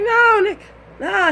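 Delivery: retold refusal of her boyfriend, high and loud; shrill refusal. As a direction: yelp out a flat refusal. Refusing his come here.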